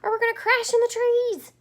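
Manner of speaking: high, loud squeak; high loud squeaky worry. Acted. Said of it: "Stellaluna squeaked".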